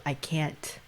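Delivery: hushed, breathless I can't; whispered panic. Retold or imitated. Mother retelling her panicked plea to a friend, whispered. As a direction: let it fall to a whisper.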